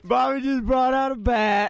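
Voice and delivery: high, loud excited report; excited laughing. Real, not acted. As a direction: blurt it out laughing. Excited over a bat.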